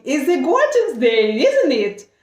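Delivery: "gorgeous" sung out high and warm, a friendly chat opener; cheerful English lady. Retold or imitated. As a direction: play the chatty English stranger. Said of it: "She talked with me so nicely".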